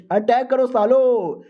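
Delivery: rally the troops with a hammed-up yell; a drawn-out charge command; rallying battle cry. Senior orders all-out attack.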